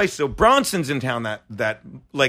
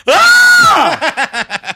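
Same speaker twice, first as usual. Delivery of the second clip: loud, high yell of fright; acted-out shriek of panic; startled scream; 11 semitones higher, 8 dB louder than usual. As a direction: scream as if shaken awake in the dark. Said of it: "I freak out".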